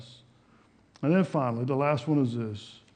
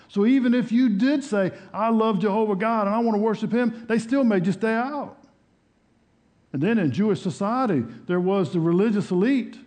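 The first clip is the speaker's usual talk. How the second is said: loud, high-pitched sermon delivery; fired-up preaching; 8 semitones higher, 7 dB louder than usual. Real, not acted. Preacher telling congregation how outsiders were shut out, fired-up preaching. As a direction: preach it out forcefully, voice raised well up.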